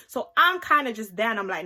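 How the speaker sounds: ordinary delivery, nothing marked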